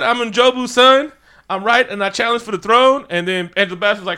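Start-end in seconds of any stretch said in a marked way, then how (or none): 0.0-3.0 higher, louder bold claim; hammed-up challenger; mock proud declaration. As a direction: announce yourself to the throne. Killmonger declares, high loud.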